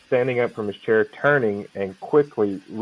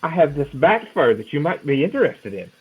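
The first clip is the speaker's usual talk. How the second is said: put-on higher, insistent old peddler voice; the old man pushing his bat fur, voice lifted up; doddering old man; 6 semitones higher than usual.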